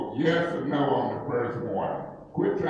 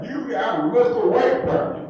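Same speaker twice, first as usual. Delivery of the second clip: menacing warning; 8 semitones higher than usual. Real, not acted. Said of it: "When you hear me start talking like this".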